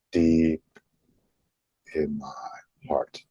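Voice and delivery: slow and deliberate. Shown as a demonstration. Repeating the affirmation for followers to breathe in, slow and deliberate. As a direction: let it sink in between the words. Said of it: "the slowness, the deliberateness in how you say that".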